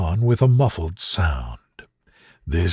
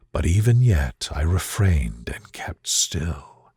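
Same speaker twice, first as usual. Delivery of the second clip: low whisper, barely breathing; 7 semitones lower than usual. A narrator's reading. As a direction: hush it, keeping deathly still. Narrator holding still in dark.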